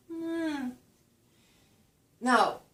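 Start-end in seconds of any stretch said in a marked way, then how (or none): 0.1-0.8 high sliding hum; hummed gliss. Shown as a demonstration. Lecturer showing the gliss, high hum. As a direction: hum the little glide. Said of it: "That little gliss".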